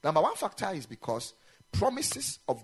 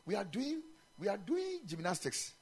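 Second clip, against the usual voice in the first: normal talking voice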